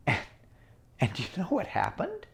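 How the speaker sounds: ordinary delivery, nothing marked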